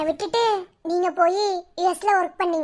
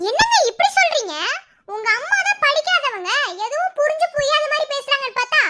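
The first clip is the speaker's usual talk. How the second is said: bitter disbelief; 6 semitones higher than usual. Acted. Wife bitterly confronting husband.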